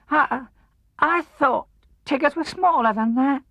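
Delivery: very high, stammering squeak; nervous tiny animal voice; timid Piglet. A narrator's reading.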